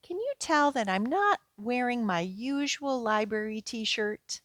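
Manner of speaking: usual speaking voice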